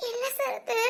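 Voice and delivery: crying quietly to her brother. Acted. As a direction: choke out the words through tears. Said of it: "சரி, okay, அழாத".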